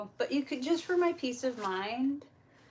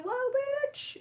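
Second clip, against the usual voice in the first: higher, rising drawn-out whoa; taken aback. Retold or imitated. Woman retells reacting to wild accusations, taken aback. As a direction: recoil at a sudden accusation.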